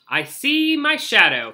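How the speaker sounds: loud, high proclamation; announcing. Acted. Groundhog announcing his forecast to the crowd, loud and proud. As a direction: puff up and declare it. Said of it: "he announced".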